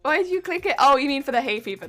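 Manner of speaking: ordinary talk, nothing marked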